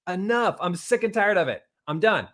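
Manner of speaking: high and loud, resolute; fired-up motivational insistence; emphatic declaration. Shown as a demonstration. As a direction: declare you're finished. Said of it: "exclamation point".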